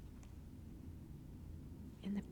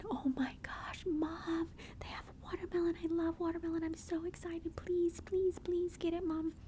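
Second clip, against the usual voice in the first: put-on higher whispered child voice, eager and pleading; be the little boy begging Mom in a whisper; whispered eager son begging mom to buy watermelon; whispered excited kid; 7 semitones higher than usual.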